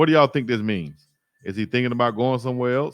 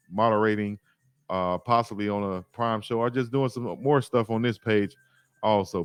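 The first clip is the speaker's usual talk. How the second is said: usual speaking voice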